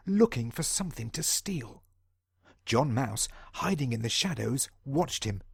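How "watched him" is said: ordinary delivery, nothing marked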